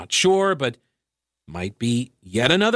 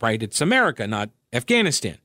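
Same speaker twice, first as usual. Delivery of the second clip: ordinary delivery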